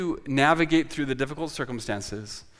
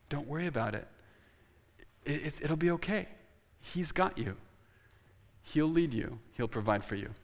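a light, soft-spoken New Jersey accent; 7 dB quieter than usual. Shown as a demonstration. Preacher reassuring the congregation in a New Jersey accent. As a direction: reassure them the way a New Jersey local would. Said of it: "I'd say that in a New Jersey accent".

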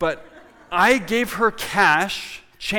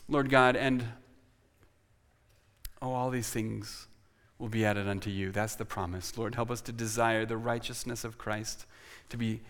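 a soft, gradually quieter closing prayer; 3 semitones lower, 3 dB quieter than usual. Acted. Pastor closing the sermon in prayer, softly earnest. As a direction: pray earnestly over the congregation, letting it fade.

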